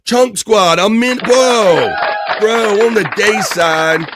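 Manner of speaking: high, loud, stretched whoa; light up at a friend showing up; excited shout-out. Real, not acted. Host greeting a surprise chatter, high and loud.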